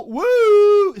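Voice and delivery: loud happy cheer; excited whoop. Real, not acted. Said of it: "cheering Patreon".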